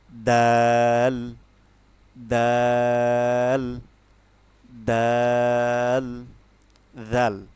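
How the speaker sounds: slow, drawn-out loud দাল with a firm d; firm dal. Shown as a demonstration. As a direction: press দাল hard and long. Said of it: "দাল হচ্ছে শক্ত থাকবে".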